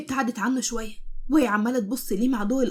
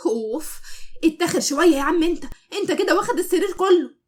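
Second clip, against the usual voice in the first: irritated, higher and louder complaint; annoyed; 5 semitones higher, 3 dB louder than usual. A narrator's reading. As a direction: grumble at him pushing over. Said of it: "مسك بكل ضيق".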